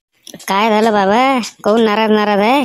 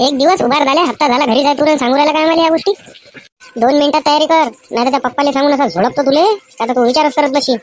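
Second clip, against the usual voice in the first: higher-pitched angry mother voice, fast at the end; mother yelling at her son to get ready for school; scolding mother; 5 semitones higher than usual. Acted. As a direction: nag the lazy son like a furious village mom.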